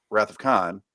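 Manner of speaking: ordinary delivery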